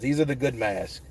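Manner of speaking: muffled behind a mask; talking through a mask; through a face mask. Real, not acted. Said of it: "the mask is needed".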